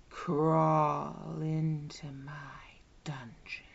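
slow unvoiced whisper. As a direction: breathe the command slowly. Whispered command in hypnosis.

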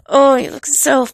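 ordinary talk

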